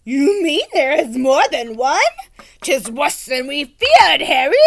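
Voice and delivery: put-on loud, pompous old knight. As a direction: declaim it like a gallant old fool. Knight voiced in story.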